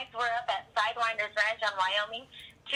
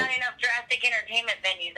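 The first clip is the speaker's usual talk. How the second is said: normal talking voice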